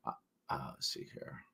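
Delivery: whispered to herself; whispering. Real, not acted. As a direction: breathe it under your breath. Muttering to herself while searching.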